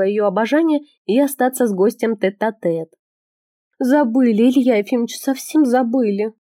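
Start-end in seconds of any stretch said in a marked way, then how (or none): 3.8-6.3 slow, drawn-out reproach. Acted. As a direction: stretch the words, tenderly reproachful. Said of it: "протянула она".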